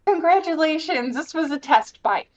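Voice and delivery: high, loud pompous declaration; mock grand announcement. Speaker imitating the villain declaring the puzzle a test, mock grand. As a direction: announce it like a proud little villain. Voicing Rules card.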